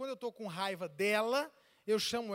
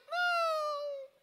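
very high, drawn-out no; mock her plaintive denial; imitated wife; 4 dB louder than usual. Imitates wife's denial again.

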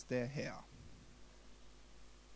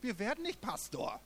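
defiant heart; 9 semitones higher than usual. Retold or imitated. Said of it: "Mein Herz hat gesagt".

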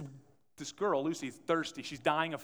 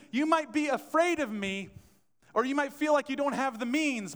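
higher and louder, pleading with the crowd; fervent preaching; 9 semitones higher, 5 dB louder than usual. Acted. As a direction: lift it into a passionate call. God's invitation, preached.

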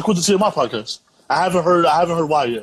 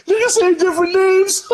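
cackling, high pitched; laughing hard. Real, not acted. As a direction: laugh the words out.

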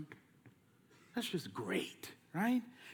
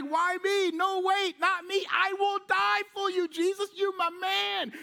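protesting Peter; 8 semitones higher, slower than usual. Retold or imitated. Peter protesting his loyalty to Jesus, high and pleading. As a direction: plead your loyalty, pitched up. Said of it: "And Peter's like".

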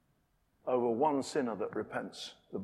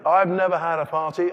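louder, higher indignant cry; loud complaint; 5 semitones higher, 9 dB louder than usual. Acted. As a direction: shout the grievance. Repeated complaint, first take.

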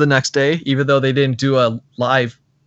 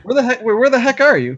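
surprised; 9 semitones higher than usual. Real, not acted. Surprised at the distance.

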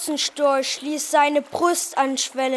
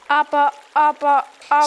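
quick, nervous repeated stammer; anxious stutter; 4 dB louder, much faster than usual. A narrator's reading. Lion objecting to the stork's plan, anxious stutter. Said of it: "stotterte der Löwe ängstlich".

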